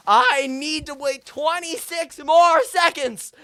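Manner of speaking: high, loud, drawn-out yell; desperate scream. Acted. Customer stalling atop the cash register, in a desperate scream. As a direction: scream it slowly, stretching the words.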